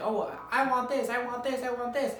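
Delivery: high, whiny voice of needy people, repeating; squeaky needy voice of everyone asking; high whiny demands. Pretend. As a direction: mimic pestering people who want things.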